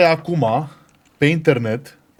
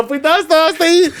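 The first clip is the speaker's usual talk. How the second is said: high, laughing reply; 12 semitones higher, much faster than usual. Real, not acted. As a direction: laugh while agreeing. Laughing agreement.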